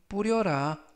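usual speaking voice

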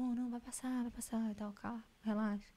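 very soft, hushed reassurance; soothing. Shown as a demonstration. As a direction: murmur to calm someone down. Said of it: "Tem vezes que eu fico assim". (acalmando, bem baixinho)

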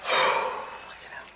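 slow breathy curse under the breath; exasperated whisper. Real, not acted. Player cursing the game under the breath, slow whisper.

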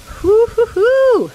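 high, loud, slow whoops; loud happy whooping at the grill; whooping cheer. Real, not acted.

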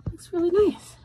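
talking with mouth held open; mouth propped open. Real, not acted. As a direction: mascara face, jaw dropped open. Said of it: "I'm doing the weird mascara face".

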